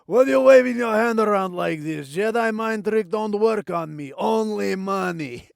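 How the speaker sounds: loud put-on caricature accent; slave owner impression. Slave owner rebuffing a Jedi's mind trick, caricature voice. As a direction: play the hammy, unbudging alien trader. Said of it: "and he goes".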